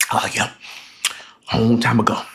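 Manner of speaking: low, soft imitation of a downcast man; sad-story voice. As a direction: answer low and subdued, as if it hurts. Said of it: "turning it into a fucking, like, sad-ass story".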